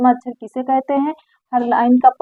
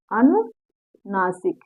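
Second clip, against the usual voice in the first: अनुनासिक said very slowly and quietly, syllable by syllable; slow, soft drawn-out teacher's repetition; 4 semitones lower, 6 dB quieter, much slower than usual. Shown as a demonstration. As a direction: drill अनुनासिक slowly so the class can repeat. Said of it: "क्या कहते हैं?". (बहुत धीरे और धीमी आवाज़ में दोहराना)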